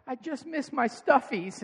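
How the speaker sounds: high, slow, mock-pitiful kid voice; sad child. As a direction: imitate a little boy missing his toys. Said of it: "What are you sad about?".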